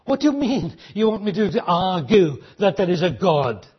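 normal talking voice

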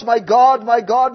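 loud anguished call; cry from the cross. Acted. Jesus crying from the cross, loud anguished call. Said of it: "You hear the cry on that cross".

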